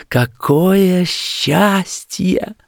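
high, slow, drawn-out rapture; slow blissful exclamation; rapturous joy. A narrator's reading. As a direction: stretch each word out, pitched high. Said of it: "твердя лишь".

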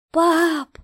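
high, loud frightened call; distant child's cry. A narrator's reading. Daughter from under the floor, high desperate call. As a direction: cry out for your father. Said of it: "Слабый голос донёсся из-под пола".

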